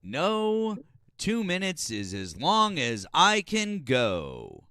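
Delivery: loud, high, drawn-out mock proclamation; mimicking the caller's voice. Caller telling his wife off in bed, loud mock voice. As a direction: belt it out slowly in a high voice. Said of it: "if he talked like that all the time".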